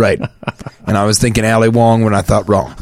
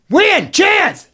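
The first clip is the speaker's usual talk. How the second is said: high pitched pitchman. Acted. Hammed-up high sales pitch.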